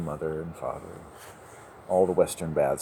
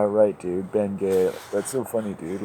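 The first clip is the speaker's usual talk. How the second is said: ordinary talk, nothing marked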